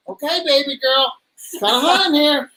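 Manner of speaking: acted-out reply. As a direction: pitch it up as a playful retold reply. Acting out reply under full mask.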